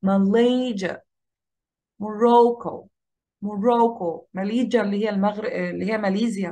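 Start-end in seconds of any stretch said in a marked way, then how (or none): 0.0-1.0 ma-LAY-sia, ماليجا stretched in the middle; ماليجا falling after a strong middle; middle-syllable stress. Shown as a demonstration. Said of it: "الستريس هنا". 2.0-4.3 mo-RO-cco, strong long "ro" in موروكو; موروكو with light first and last syllables; middle-syllable stress. Shown as a demonstration. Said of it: "الاسترس على المقطع اللي في النص".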